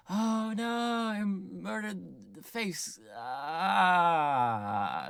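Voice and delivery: put-on high, drawn-out kid panic; hammed-up wail of discovery; mock horror. Boy finding the murdered face, mock-horrified.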